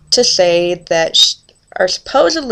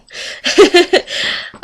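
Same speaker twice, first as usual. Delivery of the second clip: a high, airy burst of laughter at herself; high breathy laugh; 11 semitones higher than usual. Real, not acted. Laughing at her own fast talk, a high airy burst of laughter.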